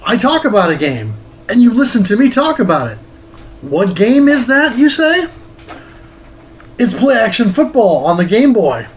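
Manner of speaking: loud, bright-energy host greeting the viewers; upbeat show host intro. Acted. Host welcoming Game Boys and Game Girls, big energetic greeting. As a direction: hype the show open like a TV host.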